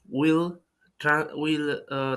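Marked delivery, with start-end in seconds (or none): none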